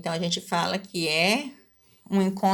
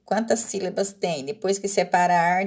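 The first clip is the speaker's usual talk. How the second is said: ordinary delivery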